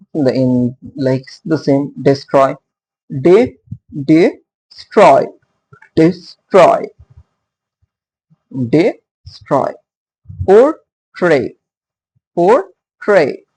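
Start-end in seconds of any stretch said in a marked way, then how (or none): 3.2-6.9 destroy with TROY said louder; stress on TROY. Shown as a demonstration. Teacher drilling the class on stress, de-STROY. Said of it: "So the stress is on tray". 10.4-13.4 Portray with TRAY lifted and stressed. Shown as a demonstration. Teacher showing students iambic stress, por-TRAY. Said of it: "So the stress is on tray".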